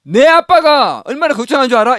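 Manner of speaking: 내 아빠가 said loud and high, stressing 내; odd stress on 내. Shown as a demonstration. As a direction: scold with an odd 내 up front. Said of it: "이렇게 얘기 안 하잖아요".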